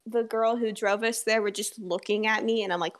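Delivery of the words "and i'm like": usual speaking voice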